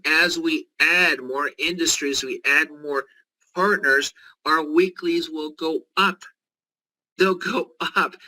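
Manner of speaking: rising sales-pitch enthusiasm; mounting excitement. Real, not acted. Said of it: "More exciting?".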